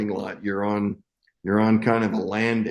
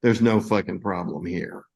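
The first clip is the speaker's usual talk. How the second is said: ordinary talk, nothing marked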